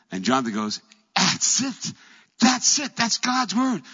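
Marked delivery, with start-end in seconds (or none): 1.2-3.8 high, loud, triumphant cry; excited. Retold or imitated. Jonathan hears God's sign and cries out in excitement. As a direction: leap on the sign with joy.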